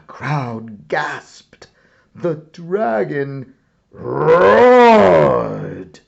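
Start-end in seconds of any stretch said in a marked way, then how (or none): 3.9-6.0 very slow, loud roaring words; drawn-out roar. A narrator's reading. Narrator roars for the dragon to the crowd, very slow and loud. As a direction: roar the word out.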